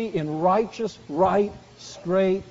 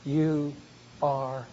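softer, lower with long pauses; closing the psalm in a hushed voice; fading tender close; 5 semitones lower, 7 dB quieter, slower than usual. Real, not acted. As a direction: let each word fall away gently. Climactic quiet ending.

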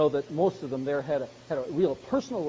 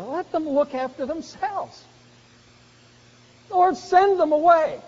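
higher, slow brush-off; mock dismissive disciples; 9 semitones higher, 5 dB louder than usual. Preacher voicing disciples brushing off the hungry crowd, dismissive. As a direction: wave them off like a bothered disciple.

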